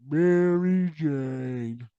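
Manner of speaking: Mary Jane drawn out very slowly; slow emphatic. Real, not acted. Comedian revealing the translation, very slow. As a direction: land the punchline word by word.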